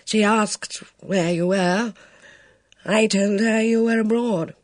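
put-on a kindly old man struggling to say it; hesitant elderly officer, earnest; hesitant old colonel. Luscombe quietly admits.

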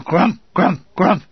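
vocalised shell bursts, rolled and thudding; short, booming explosion noises; mortar blast imitation. A narrator's reading. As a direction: imitate mortars landing one after another. Imitates mortar bombs landing.

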